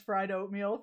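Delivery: ordinary delivery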